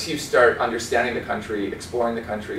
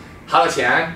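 "好多钱?" in lilting Sichuanese; Sichuan dialect; 3 semitones higher than usual. Shown as a demonstration. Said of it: "but in Sichuanhua".

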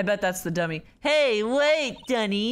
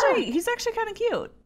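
high gushing coo; 9 semitones higher, much faster than usual. Real, not acted. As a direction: gush over the cute puppet. Cooing over a cute puppet.

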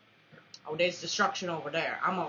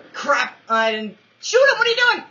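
frustrated outburst; 8 semitones higher, 10 dB louder, faster than usual. Real, not acted. Frustrated outburst after a missed shot.

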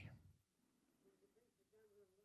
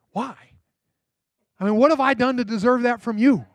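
higher and louder, incredulous; stunned disbelief; 6 semitones higher, 5 dB louder than usual. Retold or imitated. Preacher voicing the stunned boy asking the mother why, incredulous. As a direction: blurt it out in disbelief.

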